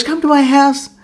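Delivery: high, mock seductive invitation; teasing come-on. Judy luring the player home, mock teasing. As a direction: lift it high like a put-on tease. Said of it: "like, fucking cock teasing you".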